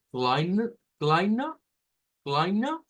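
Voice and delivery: teacher models "kleine" slowly, then repeats it; German pronunciation demo. Shown as a demonstration. Teacher models the word for the class to repeat, pronouncing it carefully. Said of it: "Esta frase es la que vamos a pronunciar ahora".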